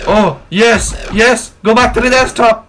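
triumphant cheering. Real, not acted. Celebrates escape back to desktop.

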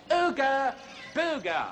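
slow, drawn out ooga booga; catchphrase call. Acted. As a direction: say "Ooga Booga" slowly, like a show slogan. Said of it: "the term we always say".

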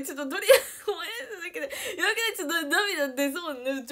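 loud, high and near tears; tearful, nasal. Real, not acted. Telling viewers about awful durian, real tearful outburst. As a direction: wail it with a teary, stuffed-up nose. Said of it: "鼻声っぽくなってきた".

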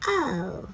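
o with mouth slightly open, pushed; o sound. Shown as a demonstration. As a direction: model the o. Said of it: "you push with your voice".

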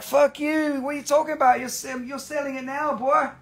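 high mock-indignant voice; mock outraged buyer. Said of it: "Jennifer's like".

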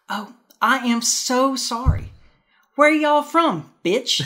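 put-on high, loud, slow Southern belle accent with a sweet sting. Host acting the Southern belle's punchline, high and loud in a Southern accent. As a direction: drawl it sweetly up high, then land the punchline. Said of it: "And the Southern belle says".

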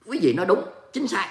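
usual speaking voice

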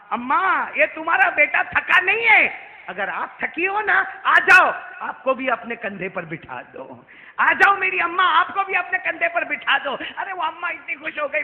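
high, loud preaching that climbs to a shout; impassioned sermon delivery; full-force preaching. Acted. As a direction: preach it out to the crowd, rising to a cry. Prophet's words to old woman.

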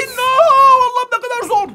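high, loud cry of dismay; loud outburst after failing; exasperated wail. Real, not acted. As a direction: wail at how hard it is. Loses control in the game.

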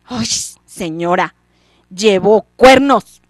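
cuernos fuerte y lento, burlón; arrástralo con desprecio; burla grosera. Acted. Muchacho se burla de la mujer. (tono burlón y fuerte)

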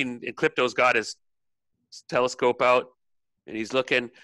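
ordinary delivery

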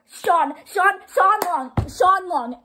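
high, loud fan cries; excited name-calling. Real, not acted. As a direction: call the name over and over, high and amazed. Calls musician's name in excitement.

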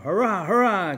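loud, high cheering burst; boy bursting in with happy news; excited cheer. A narrator's reading. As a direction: shout it for joy, bursting with news. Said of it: "all excitement".

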